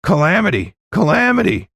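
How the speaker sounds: high, panicked holler; squawking shout. A narrator's reading. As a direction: squawk it out like a frantic alarm. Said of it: "shouted like the devil was at his back".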